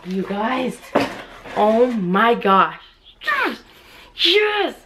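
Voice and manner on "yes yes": very high-pitched joyful yes; real excitement. Real, not acted. Collector celebrates getting the Freddy Funko, excited squeal. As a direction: squeal each yes in triumph. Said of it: "excited right now".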